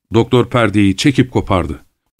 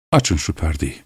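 lower, softer instruction; subdued order; 6 semitones lower, 7 dB quieter than usual. A narrator's reading. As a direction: give the order quietly and low. Doctor's first order to open curtain. (alçak sesle, sakince emrederek)

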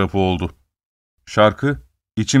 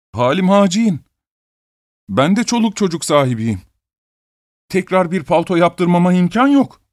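higher, tearful begging; pleading, near tears; 7 semitones higher than usual. A narrator's reading. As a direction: beg for mercy, voice close to crying. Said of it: "Ağlar gibi bir sesle".

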